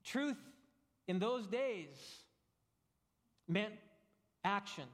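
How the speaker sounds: preacher weighing every word; emphatic slow build. Real, not acted. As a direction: lay each word down slowly, pausing between them. Preacher stressing key words.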